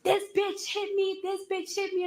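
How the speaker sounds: high-pitched mock hysterics; hammed-up high outcry, not shouted; imitating wilding out. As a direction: mimic a frantic accuser, voice shooting up. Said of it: "wilding out".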